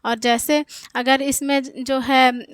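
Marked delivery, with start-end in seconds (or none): none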